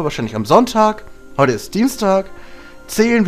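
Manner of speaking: ordinary delivery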